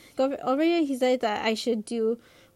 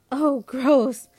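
louder, whiny sister's protest; wailed disgust; 2 semitones higher, 8 dB louder than usual. A narrator's reading. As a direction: wail it out in disgust. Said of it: "Lynette wails".

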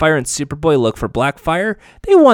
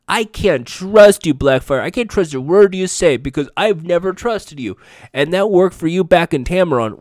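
raised, heated voice, pushing each word; dramatic accusation; 5 semitones higher, 5 dB louder than usual. Acted. Starfire confronting Blackfire, a heated raised accusation. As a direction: hurl the distrust at her sister. Sisters' acted quarrel, Starfire reading.

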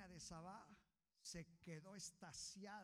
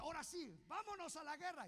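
rousing call; 11 semitones higher, 9 dB louder than usual. Acted. Prophet urging king to war.

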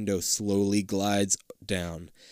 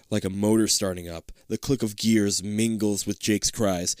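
normal talking voice

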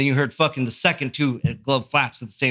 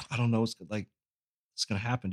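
much softer, nervous murmur; hushed worry; 6 semitones lower, 10 dB quieter, faster than usual. Retold or imitated. Kid by the counter murmuring nervously as the fight brews. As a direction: murmur it, unsure what comes next. Anxious inner thought, very quiet.

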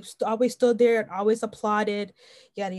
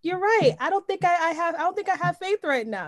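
high-pitched owning up; candid admission to God; 8 semitones higher than usual. Retold or imitated. Believer talking to God about lacking faith, candid admission. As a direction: concede it in a raised voice. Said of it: "sometimes it's like".

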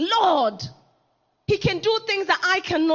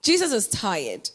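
dismissive disciples; 9 semitones lower than usual. Retold or imitated. Disciples brushing off the mother, low and dismissive. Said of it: "the disciples said".

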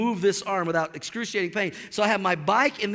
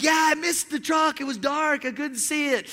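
breezy friend; 7 semitones higher than usual. Retold or imitated. Friend explains casually.

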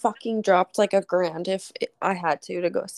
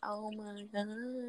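slow, very soft retold dismay; quiet, drawn-out mock exasperation; overwhelmed reaction; 15 dB quieter, much slower than usual. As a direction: let it out slowly under your breath. Reacting to partner's retold rant.

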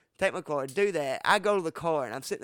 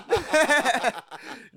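high laugh; 9 semitones higher, 5 dB louder than usual. Real, not acted. Laughing at hiding weed.

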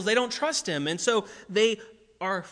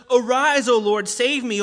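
crying out; 3 semitones higher, 8 dB louder than usual. Retold or imitated. David crying out to God.

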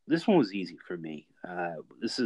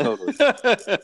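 high, slow and loud; drawn-out exaggerated; 14 semitones higher, 9 dB louder, much slower than usual. Real, not acted. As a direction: stretch it out in mock agreement. Drawn-out mock agreement.

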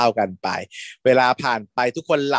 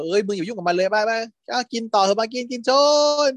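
dismissive friend; 10 semitones higher than usual. Retold or imitated. Friend brushing him off.

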